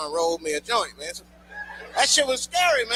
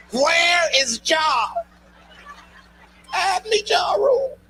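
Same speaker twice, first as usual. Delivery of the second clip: higher, louder, frantic mock distress; mock panic; 7 semitones higher, 4 dB louder, slower than usual. Comic at home begs for [name], in hammed-up loud panic. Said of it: "I be in the crib like".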